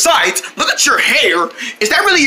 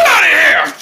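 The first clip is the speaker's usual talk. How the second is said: loud, higher yell, run together; shouted order; 5 semitones higher, 4 dB louder than usual. Acted. Vendors driving away the mocking boys, shouted order. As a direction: burst out with a sudden shout. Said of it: "We said".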